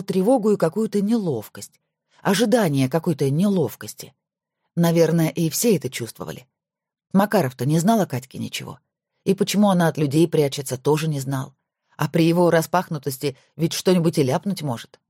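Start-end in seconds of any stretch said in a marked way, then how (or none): none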